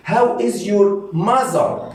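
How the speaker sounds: slow, lifted language-lesson delivery; slow modelling. Shown as a demonstration. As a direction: stretch each word out for learners. Teacher models question for learners.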